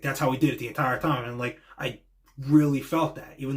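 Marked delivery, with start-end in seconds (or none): none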